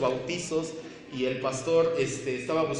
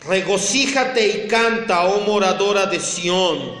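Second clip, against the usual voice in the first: loud, raised voice with drawn-out phrase endings; forceful preaching; 4 semitones higher, 6 dB louder, slower than usual. Acted. Pastor proclaims Isaiah to the congregation, forceful preaching. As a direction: proclaim each phrase with full weight.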